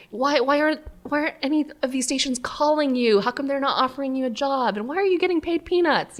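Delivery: mock parental protest, climbing in pitch; imitating baffled parents. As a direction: pester like a puzzled parent. Parents' nagging questions acted out.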